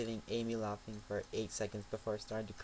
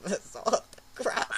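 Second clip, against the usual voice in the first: amused disbelief; 7 semitones higher, 5 dB louder, slower than usual. Real, not acted. Laughing reaction to text.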